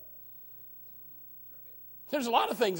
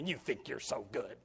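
snide remark; 7 semitones lower, 6 dB quieter than usual. Retold or imitated. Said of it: "some snide little remark".

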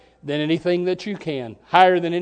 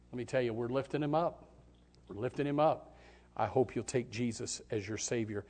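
softer, lower, gentle pleading; soft altar call; 7 semitones lower, 9 dB quieter than usual. Acted. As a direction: coax them quietly toward the altar. Quiet altar call invitation.

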